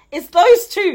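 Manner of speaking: laughing through the punchline; giggly and loud. Real, not acted. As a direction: crack up as you land it. Punchline about Yoruba girls.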